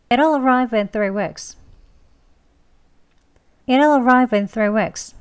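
"It'll arrive in three weeks" linked together at a natural pace. Shown as a demonstration. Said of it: "조금 빨리 합니다".

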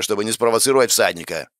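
plain reading voice

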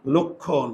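লক্ষণ said softly as "lokkho"; teacher softly models লক্ষণ; pronunciation demo. Shown as a demonstration. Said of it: "এভাবে দেখো".

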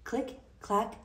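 ordinary delivery, nothing marked

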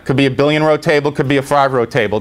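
normal talking voice

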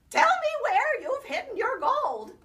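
high, loud furious demand; angry demand. Acted. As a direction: shake the truth out of him. Nancy shaking the leprechaun.

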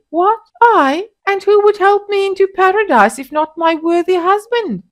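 very high, loud feigned shock; mock innocence. A narrator's reading. Wife feigning shocked innocence to taunt her husband, very high. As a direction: play the innocent to taunt him. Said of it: "Noumbe was mocking him".

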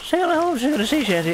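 high-pitched playful patter; playful high repeat. Pretend. Showing he can repeat the tongue-twister, high voice. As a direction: rattle it off in a high silly voice.

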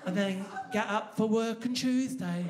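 put-on English accent. English person planning work on Tuesday, slow English accent. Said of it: "the English are very good at going".

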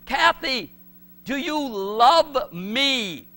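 slow, pleading question to Kathy; asking his fiancée. Retold or imitated. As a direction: stretch out the question tenderly. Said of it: "and I said to her".

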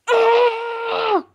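short high yelp of fright; startled scream. Acted. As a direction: jump and scream. Jeffy startled.

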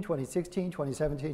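ordinary delivery

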